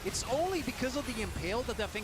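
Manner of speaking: rising excited caster call; esports caster. Acted. Live play-by-play of a fight.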